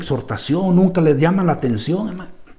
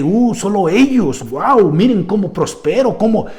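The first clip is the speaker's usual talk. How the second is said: higher, boastful mock of a proud pastor; mock bragging; 6 semitones higher than usual. Preacher mocks a boastful prosperity pastor, bragging higher. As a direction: show off your riches in a mocking tone. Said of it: "piensan que".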